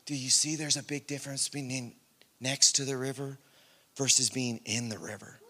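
very soft, low voice; quiet divine question. Retold or imitated. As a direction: ask it low and hushed, with pauses. Retold quiet question from God.

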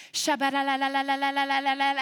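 fast, loud mock praying in tongues; mock tongues babble. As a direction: rattle off a parody of tongues. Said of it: "we start praying it away".

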